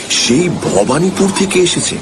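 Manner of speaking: ordinary delivery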